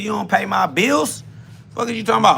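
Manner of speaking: ordinary delivery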